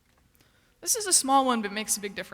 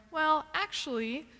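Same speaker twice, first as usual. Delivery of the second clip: drawn-out, higher "well, actually"; pedantic correction; 5 semitones higher, slower than usual. Acted. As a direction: slowly start a know-it-all correction, rising at the end. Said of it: "when you catch yourself going".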